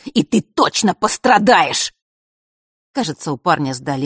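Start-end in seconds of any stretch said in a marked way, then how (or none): 0.1-1.9 slow, raised, loud menace; sinister boy foretelling doom; ominous threat. A narrator's reading. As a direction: drag out the threat as it builds. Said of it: "зловеще пообещал Марк".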